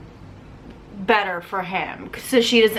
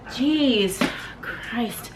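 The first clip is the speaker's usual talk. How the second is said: ordinary talk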